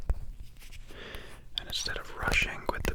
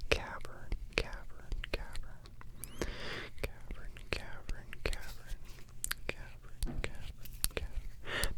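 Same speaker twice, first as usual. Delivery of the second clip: whispered trigger words; 6 dB quieter, slower than usual. Shown as a demonstration. Repeats trigger word while carving.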